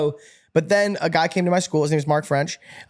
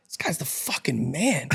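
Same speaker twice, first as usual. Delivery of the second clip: awed hush; 2 semitones lower, 6 dB quieter, slower than usual. Retold or imitated. Awed thought in the BMW.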